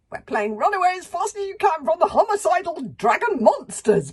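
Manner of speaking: high, loud, frantic rush of words; panicked panting. A narrator's reading. Fishlegs running from dragons, high panicked cry. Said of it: "panted Fishlegs".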